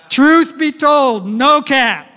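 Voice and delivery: loud, high, slow hyped-up opener; playful hype opening. Real, not acted. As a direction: grab the room with a loud grin.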